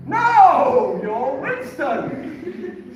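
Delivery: very loud, high flat contradiction; booming Irish rabbi. Retold or imitated. Rabbi insisting on the wrong name, booming Irish voice. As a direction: shout him down, slowly. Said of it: "He said".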